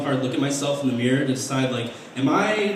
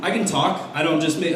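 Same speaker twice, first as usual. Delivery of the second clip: usual speaking voice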